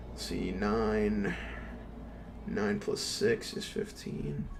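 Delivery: slow, low mumble while counting; muttering to himself. Real, not acted. Streamer counting summon numbers to himself, slow quiet mutter. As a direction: work the sum out under your breath, slowly.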